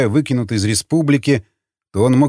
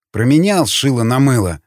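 ordinary delivery

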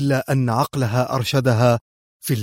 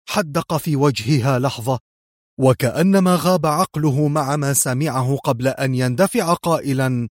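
ordinary delivery

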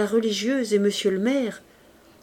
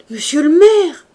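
loud, high desperate cry; cried out; 9 semitones higher, 12 dB louder than usual. A narrator's reading. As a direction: call out to the mayor in alarm. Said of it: "cria Fantine".

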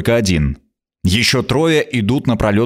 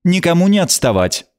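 sharp, higher command; 7 semitones higher than usual. Acted. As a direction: snap the order. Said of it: "велела она резко".

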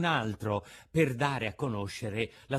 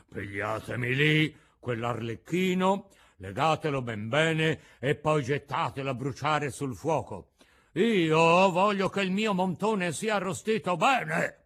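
rattling voice; 5 semitones higher, 7 dB louder than usual. A narrator's reading. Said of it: "con voce rantolosa".

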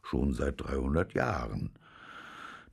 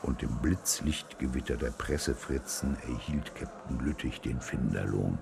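ordinary delivery

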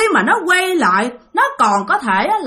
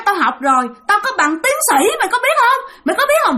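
high, shrill bragging yell; yelling ghost; 10 semitones higher than usual. Retold or imitated. Possessed woman's ghost announces its name, high shrill yell. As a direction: shout your name like a boast. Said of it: "Mà la làng thấy ghê lắm".

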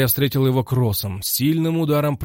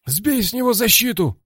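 shouting; 12 semitones higher, 5 dB louder than usual. A narrator's reading. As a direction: yell it from the corner. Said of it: "крикнул Орлам".

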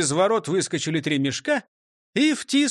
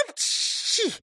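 high, airy sneeze; acted sneeze. Hidden robber sneezes from back seat, high achoo. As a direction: let slip a high achoo. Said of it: "кто-то чихнул".

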